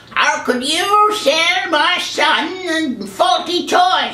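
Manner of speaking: high, slow, hammed-up mother; put-on high mom voice; indignant mom. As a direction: draw out a mock-outraged complaint. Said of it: "they haggled with the girl".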